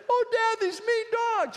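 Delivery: put-on very high, loud whining child; whiny kid. Boy whining to his dad about mean dogs, high and loud. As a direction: wail it like a scared ten-year-old. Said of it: "I said".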